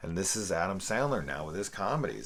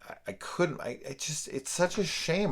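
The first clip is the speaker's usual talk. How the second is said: normal talking voice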